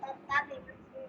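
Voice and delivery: small, low, soft voice; real timid-volume recitation, low and rushed; too quiet. Real, not acted. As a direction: repeat it quickly, barely audible. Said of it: "મોટેથી તો બોલ".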